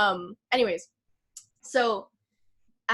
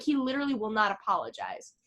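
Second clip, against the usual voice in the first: normal talking voice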